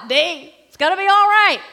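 loud, high, fervent declaration to herself; loud self-declaration. Real, not acted. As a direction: shout it over yourself like a promise. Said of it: "say it myself".

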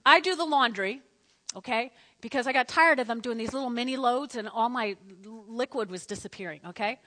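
ordinary talk, nothing marked

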